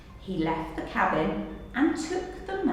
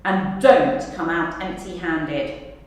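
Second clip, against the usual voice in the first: barking; 4 semitones lower, 5 dB louder than usual. Acted. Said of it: "barked Crook".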